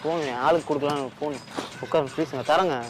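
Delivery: meek pleading. Acted. Frightened man begs softly.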